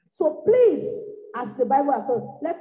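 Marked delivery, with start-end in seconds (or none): 0.1-0.8 pitched up, louder plea; a raised pleading so please. Real, not acted. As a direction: call out to the congregation. Preacher pleads loudly.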